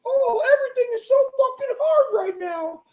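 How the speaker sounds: very high squeaky shouted whine; mocking complainer. Mocking people who complain, high squeaky shout. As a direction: squeak it like a cartoon crybaby.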